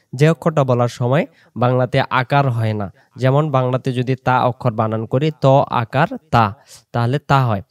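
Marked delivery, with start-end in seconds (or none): none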